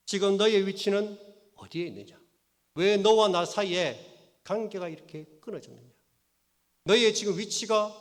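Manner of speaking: loud, rising plea of God asking where you stand; God's urgent question. Acted. God asking Adam where he stands, loud and urgent. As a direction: press the question hard and loud.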